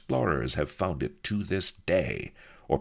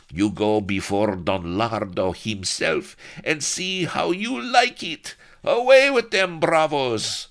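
raised threats building to a loud, high order; angry Spanish corporal; 6 semitones higher, 5 dB louder than usual. A narrator's reading. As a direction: threaten in Spanish accent, finish shouting. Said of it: "silenced them with an angry gesture".

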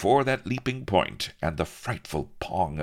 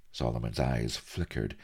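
usual speaking voice

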